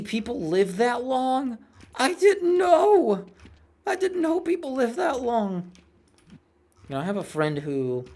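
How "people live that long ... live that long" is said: high, drawn-out mock disbelief; mock amazement. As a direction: act astonished, stretching the words way up high. Mock amazement at long lifespans.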